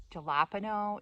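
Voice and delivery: "Jalapeno" as juh-LAP-uh-no; hard J on "Jalapeno", stress on LAP; anglicized "Jalapeno"; wrong on purpose. Shown as a demonstration. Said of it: "pronounce this wrong on purpose".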